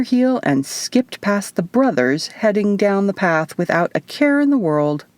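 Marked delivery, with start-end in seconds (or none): none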